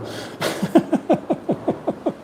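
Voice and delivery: long breathy chuckle; real laugh. Real, not acted. Man amused by his case officer's note, chuckling. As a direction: laugh it out in a run of breathy bursts.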